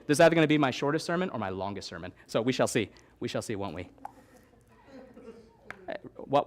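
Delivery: ordinary delivery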